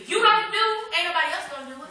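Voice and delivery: fiery preacher's call; preaching at full force. Acted. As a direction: shout it to the church.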